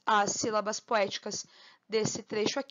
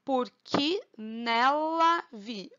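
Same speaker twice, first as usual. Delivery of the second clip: "Porque nela vi" very slow, each syllable stretched; slow, drawn-out scansion of a verse; much slower than usual. Shown as a demonstration. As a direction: separate every syllable for counting. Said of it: "Um, dois, três".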